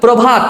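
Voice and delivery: প্রভাত ending in a closed t, no a; final vowel dropped. Shown as a demonstration. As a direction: clip the end of প্রভাত. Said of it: "অটা বাদ দিয়ে উচ্চারণ করি". (প্রভাত শেষে অ বাদ দিয়ে)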